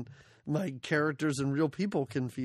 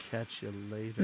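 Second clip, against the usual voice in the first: low, soft deadpan Chuck Norris; Chuck Norris line; 9 semitones lower, 6 dB quieter than usual. Retold or imitated. Retelling the movie's exit line, low deadpan. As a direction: mumble it low like a tough guy. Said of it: "Chuck Norris pulls it down and goes".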